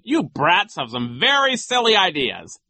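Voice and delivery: high, loud, pompous teasing; sneering high-voiced official; Nero. A narrator's reading. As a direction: taunt the children with a silly joke. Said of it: "making idiotic jokes".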